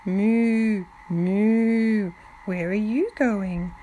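a hint of a mooing cow; lightly voiced cow. A narrator's reading. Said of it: "asked Missus Cow".

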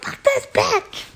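very high-pitched mock outburst; flipping out. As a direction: imitate a woman flipping out, shrieky and high. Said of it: "Teresa starts flipping out".

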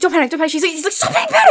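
rising panicky voice. Retold or imitated. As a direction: pitch climbing in alarm. Panicked leech scene.

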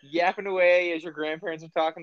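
usual speaking voice

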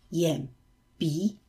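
usual speaking voice

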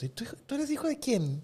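put-on soft, high old-man question; lightly voiced future grandpa; imitating himself as grandpa. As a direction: quiz the grandkids like a doting grandfather. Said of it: "le voy a decir a mis nietos".

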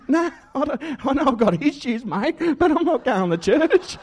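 high, dragged-out mock whine of refusal; stubborn refusal. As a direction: dig your heels in, half laughing. His own stubborn refusal.

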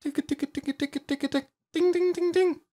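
high ticking then a ding; typewriter sounds. Retold or imitated. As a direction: voice the kettle ticking and dinging. Imitating kettle boiling and dinging.

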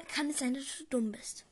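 hushed whisper on "dumm bist"; whispered question. Shown as a demonstration. To a friend, the dumb-question line whispered. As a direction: breathe the question as a secret. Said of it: "Und einmal".

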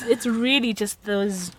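normal talking voice

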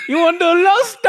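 very high, loud pushy waiter voice; high waiter caricature. Retold or imitated. Waiter pushing lobster on diners, very high voice. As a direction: squeak the offer through laughter. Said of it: "And he's".

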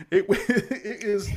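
laughing while admitting he cried. Real, not acted. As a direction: chuckle it out, high. Laughing at his own crying.

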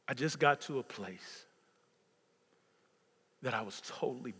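soft, low confession of emptiness; hushed testimony. Retold or imitated. Pastor retelling Dante's testimony, hushed. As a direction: let it sink to a near whisper.

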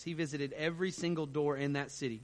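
ordinary delivery

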